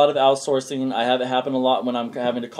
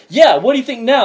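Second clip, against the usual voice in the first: higher and louder gloat; laughing mock triumph; 11 semitones higher, 10 dB louder than usual. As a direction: rub it in his face. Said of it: "he's like".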